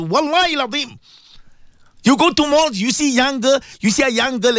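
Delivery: high-pitched, urgent sermon delivery; impassioned preaching. Acted. Preaches at full force.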